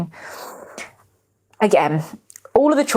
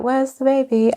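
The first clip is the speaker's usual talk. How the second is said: higher, sweetly polite question; mock polite ask; 8 semitones higher than usual. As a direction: hide the panic behind a nice voice. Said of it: "So I just say".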